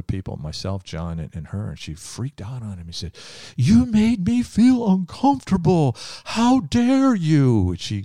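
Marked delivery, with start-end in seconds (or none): 3.6-7.7 much higher and louder, slow, mocking the woman's outrage; mocking angry woman. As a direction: mimic her indignant scolding, pitched way up and loud. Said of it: "she screamed at him".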